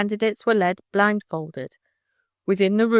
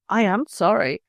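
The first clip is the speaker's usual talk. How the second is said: plain reading voice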